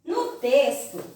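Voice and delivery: ordinary talk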